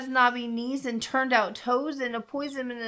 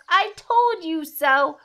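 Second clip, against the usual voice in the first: high, loud, drawn-out gloat; slow sing-song told-you-so; 7 semitones higher, 7 dB louder, slower than usual. A narrator's reading. Mouse proves itself to Gruffalo, high and smug.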